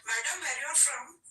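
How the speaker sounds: imitating the driver's question; lightly voiced driver asking her origin; rickshaw driver. Driver quizzing a foreign passenger, lightly voiced driver. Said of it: "First thing he'll ask is".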